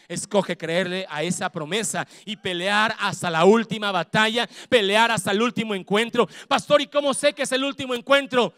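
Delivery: loud driving sermon; full-force preaching. Real, not acted. Pastor urging the church to keep fighting. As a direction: push them to fight on.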